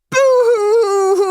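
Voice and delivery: high, drawn-out boo-hoo sob; child crying. Acted. Unseen child crying in the night, high slow sobbing. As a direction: wail it out like a small child sobbing. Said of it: "A child was crying".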